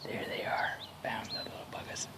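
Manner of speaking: hushed sneaking whisper; dad creeps up on the nest, whispering. Real, not acted. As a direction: whisper so the wasps won't hear. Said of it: "whispering while hunting wasps".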